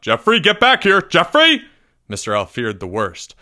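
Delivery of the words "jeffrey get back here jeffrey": high, loud shout building higher; calling out. A narrator's reading. Teacher chasing pupil into woods, urgent loud shout. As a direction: yell urgently after someone running off. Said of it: "he called out".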